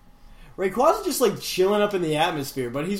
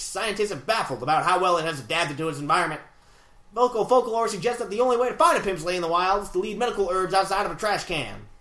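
put-on old-time radio interviewer patter; twenties gangster voice. Reading Relish Ram's email, twenties gangster voice. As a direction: talk like a vintage mobster. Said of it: "twenties interviewer voice".

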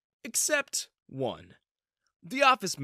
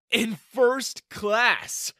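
excited; 8 semitones higher, 6 dB louder, much slower than usual. Acted. Thrilled about first class.

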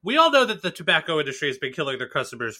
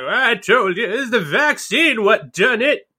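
high, loud, drawn-out crank voice; hammed-up conspiracy believer, pitched way up; mock Q supporter; 7 semitones higher, 4 dB louder, slower than usual. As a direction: crow it like a vindicated conspiracy nut. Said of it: "last remnants of Q supporters are gonna be like".

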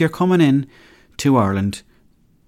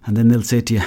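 ordinary delivery

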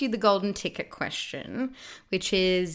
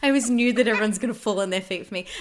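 fast boast; 10 dB louder, faster than usual. Real, not acted. Mock boasting about being hot.

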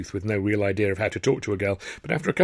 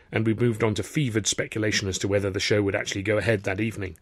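usual speaking voice

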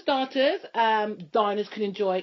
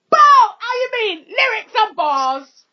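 big triumphant ad-lib shouts; hype-man shouting; 12 semitones higher, 12 dB louder than usual. Acted. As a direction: yell it to cap off the rap. Hype shouts ending her rap performance.

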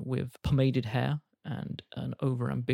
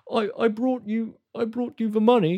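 high, stammering bear voice; nervous bear; 9 semitones higher, 4 dB louder than usual. Acted. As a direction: stumble out the apology as a timid bear.

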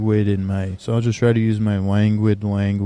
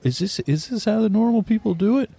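much higher, wide-eyed mock amazement; amazed wonder; 12 semitones higher than usual. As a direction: marvel at it like a kid. Amazed at his own neat packing.

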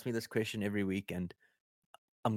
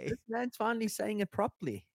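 much higher-pitched acted quote; mock amazed reaction; 12 semitones higher than usual. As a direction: act out a high-voiced mock celebration. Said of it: "Yeah, I'm like".

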